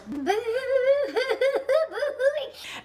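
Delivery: high, hammed-up crying noise; mock wailing. Storyteller imitating Stu breaking down, mock high wail. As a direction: wail in a long, high, stuttering whimper.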